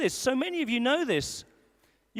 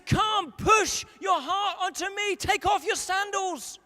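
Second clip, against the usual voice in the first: voice of God; 6 semitones higher than usual. Acted. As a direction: plead tenderly as God inviting. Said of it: "And he says".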